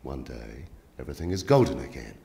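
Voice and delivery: softly intoned surrealist poem, imitated; deadpan poetry reading. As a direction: read surreal verse calmly amid chaos. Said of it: "started reading that over the mic".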